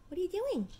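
high, soft pet voice. Real, not acted. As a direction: coo at the dog. Talking to her dog.

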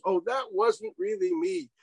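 high, slow mock denial; mock whiny excuse. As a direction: squeak out the excuse like a caught kid. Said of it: "we exonerate ourselves".

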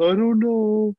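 high, drawn-out mock whimper of a kid; little child's wail, acted out; tearful three-year-old. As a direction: imitate a crying toddler who is lost for an answer. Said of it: "'cause he's three".

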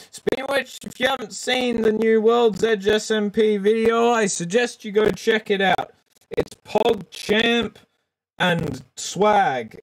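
high-pitched promo voice; mock advert delivery, pushed up high; hammed-up plug. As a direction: pitch the video high and keen, like a sales plug. Plugging the new SMP video.

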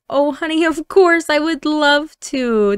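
high, gushing acceptance; delighted mom. Acted. Mom agrees to daughter's request.

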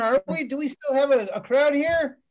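usual speaking voice

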